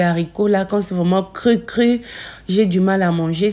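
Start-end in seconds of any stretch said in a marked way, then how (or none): none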